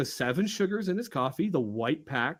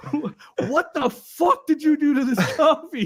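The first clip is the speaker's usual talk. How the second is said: high, loud exasperated yell; mock-yell at the clueless new guy; loud outraged boss; angry boss yelling; 8 semitones higher, 7 dB louder than usual. Angry boss quote retold.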